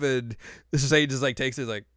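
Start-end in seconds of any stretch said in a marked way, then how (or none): none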